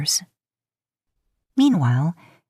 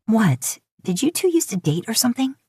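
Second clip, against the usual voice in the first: higher, sharp accusing question; suspicious Lisa; 6 semitones higher than usual. Acted. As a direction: pin him down, higher pitch.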